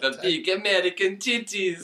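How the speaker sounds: high, slow, laughing mock Russian; hammy Russian joke; Russian accent. Friend adds a crude line in accent.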